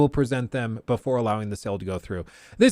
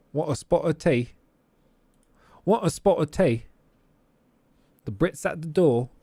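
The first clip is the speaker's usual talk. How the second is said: a light mock British accent, a touch higher; 5 semitones higher than usual. Streamer jokingly offers tea in a light British accent. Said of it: "that accent".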